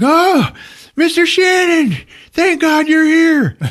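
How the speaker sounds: high, loud, slow wounded cry; hammed-up pleading wail; acted desperate relief. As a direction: call out to a rescuer with a hole in your cheek. Wounded man greets his rescuer.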